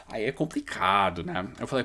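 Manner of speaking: ordinary delivery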